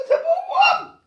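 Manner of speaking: high-pitched, laughing through the words. Real, not acted. Streamer laughing to the viewers after shrieking. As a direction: giggle it out very high.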